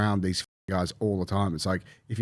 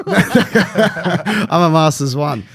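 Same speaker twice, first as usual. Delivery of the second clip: laughing loud through the words; talking high and loud through real laughter; 8 semitones higher, 9 dB louder, much slower than usual. Real, not acted. Laughing at his own ban while telling the story, laughing loud.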